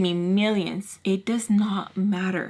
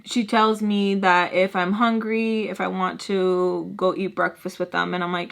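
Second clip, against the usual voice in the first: ordinary talk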